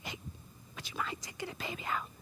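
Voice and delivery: hushed whisper to a parent. Retold or imitated. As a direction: whisper it so the church can't hear. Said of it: "And Pastor Jim, like".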